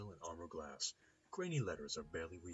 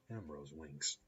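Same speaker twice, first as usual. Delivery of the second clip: ordinary talk, nothing marked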